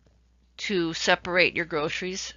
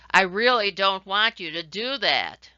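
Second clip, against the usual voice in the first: louder, firm, with "really" hit hard; loud version; 6 dB louder than usual. Shown as a demonstration. Teacher showing how loudness changes the meaning. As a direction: push the volume up on "really". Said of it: "How about looking at loudness?".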